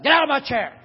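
worn-out grandpa hamming up being cross; mock gruff grandpa. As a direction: playfully growl them out of the chair. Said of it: "I'm out of breath and I'm so bad and I go".

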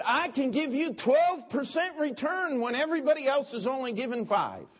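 high, loud sales-pitch voice; high, loud mock swindler; mock Madoff pitch. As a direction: sell it like a slick con man. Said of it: "He said".

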